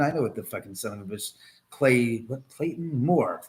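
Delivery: ordinary delivery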